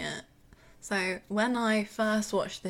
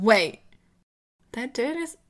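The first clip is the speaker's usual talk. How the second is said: mock alarm; 3 semitones higher, 7 dB louder than usual. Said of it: "I'm like".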